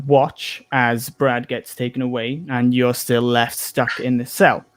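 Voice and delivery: ordinary delivery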